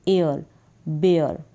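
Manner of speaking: teacher sounding out बेयर; bear pronunciation. Shown as a demonstration. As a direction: model बेयर with a light final r. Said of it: "फिर है बी को ब".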